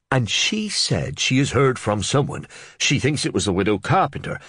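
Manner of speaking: eager to share gossip; Reeve reporting. Acted.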